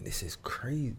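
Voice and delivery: hushed, near-whispered thought; inner whisper. Retold or imitated. Actor in audition thinking to himself, hushed whisper. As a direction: mutter the panic under your breath.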